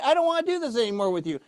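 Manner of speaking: normal talking voice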